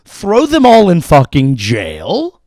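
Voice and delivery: loud, slow, drawn-out cry; outraged verdict. Acted. Comic condemning suspected insider bettors, outraged shout. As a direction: proclaim it slowly at full volume.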